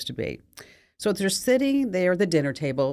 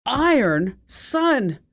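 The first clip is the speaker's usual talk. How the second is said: high, loud outraged robot father; a robot dad blowing his circuits in fury; furious yell; 7 semitones higher, 4 dB louder, slower than usual. Acted. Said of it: "he yells as loud as his vocal processor could go".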